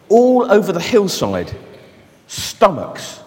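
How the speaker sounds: soft, low storytelling voice; reading a story to children, soft and low; story narration. Acted. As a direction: draw the children in quietly.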